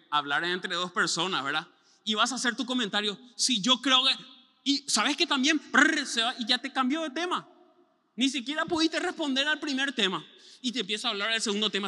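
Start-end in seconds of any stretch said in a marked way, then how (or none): 3.4-4.1 loud, higher, rushing to cut in; eager interruption. Retold or imitated. Trying to get a word in, eager and loud. 4.6-10.2 higher, louder, pushing straight on; mock rushing talker. Mimicking a nonstop talker, higher and louder.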